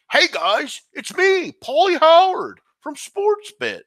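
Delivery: high, drawn-out sports-show host; hammed-up broadcaster, squeal up and stretch the words; slow, high-pitched show intro with big swoops; Paulie Howard. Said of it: "Can you do Paulie Howard?".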